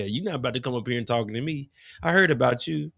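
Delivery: ordinary talk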